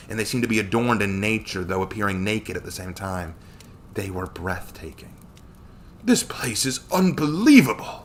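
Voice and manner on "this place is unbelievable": high-pitched, amazed gasp; gasped amazement. A narrator's reading. Samuel first sees the spring, gasped amazement. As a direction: marvel at it, voice lifted high. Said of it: "Samuel gasped".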